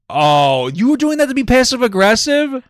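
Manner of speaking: high, loud, stunned question; shocked disbelief. Real, not acted. Husband reacts to wife's garbage confession, loudly shocked. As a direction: gasp it out in disbelief.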